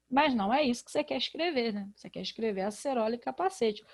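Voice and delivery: normal talking voice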